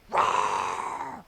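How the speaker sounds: put-on a playful storyteller's roar for kids; wolf roar. Wolf leaps from behind a tree with a mock roar.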